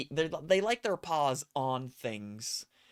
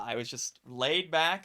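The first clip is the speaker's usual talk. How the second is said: normal talking voice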